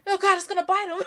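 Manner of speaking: high, loud panicked cry. Retold or imitated. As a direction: blurt it out in sudden panic. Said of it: "And I'm like".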